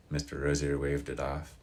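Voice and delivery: plain reading voice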